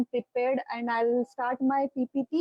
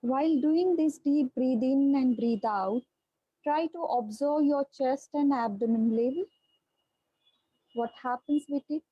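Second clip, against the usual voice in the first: guided meditation. Acted. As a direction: ease them into their breath, unhurried. Leads a guided breathing meditation.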